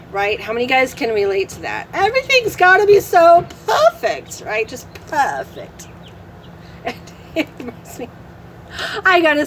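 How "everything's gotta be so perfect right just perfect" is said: mocking perfectionism.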